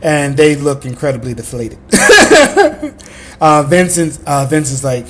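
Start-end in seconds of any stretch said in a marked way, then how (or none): 1.8-3.3 loud, high burst of laughter; laughing. Real, not acted. Player reacting to the game master's scene, real laughter.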